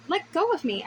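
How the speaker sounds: yell. A narrator's reading. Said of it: "I yell".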